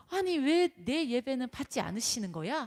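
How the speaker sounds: acted indignant protest, high and loud; mock outrage at being turned down; angry Cain. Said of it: "하면서 막 화가 났습니다". (화가 나서 따지듯이)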